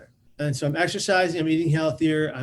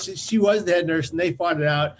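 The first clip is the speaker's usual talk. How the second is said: ordinary delivery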